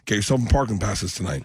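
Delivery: normal talking voice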